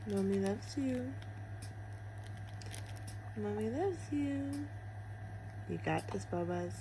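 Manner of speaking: tender. Real, not acted. Mother soothing baby before surgery.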